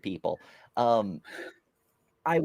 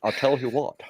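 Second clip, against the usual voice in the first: Hank Hill catchphrase; 2 semitones lower than usual. Retold or imitated. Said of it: "you had Hank Hill".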